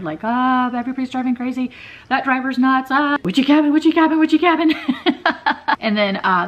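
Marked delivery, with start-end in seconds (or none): 0.2-4.7 high, loud comic panic; frantic mock panic, speeding up into laughter; scared. Retelling her scared drive down the freeway, panicking. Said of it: "when I felt really, really scared". 4.8-5.7 high burst of laughter; amused laugh. Real, not acted. Laughing at her own driving panic, amused.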